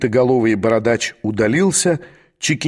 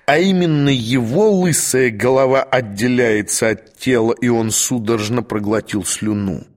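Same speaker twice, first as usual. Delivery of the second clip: ordinary delivery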